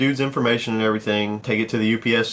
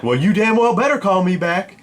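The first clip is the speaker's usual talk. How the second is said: higher-pitched huffy caller impression; irate caller; 9 semitones higher, 3 dB louder than usual. Angry caller demanding a call back, higher and indignant. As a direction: demand it in a raised, put-on voice.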